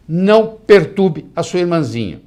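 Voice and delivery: slow, loud "Não perturbe" like a parent scolding; mock parental command. Shown as a demonstration. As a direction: give the order slowly and firmly. (ordem de pai, lenta e firme)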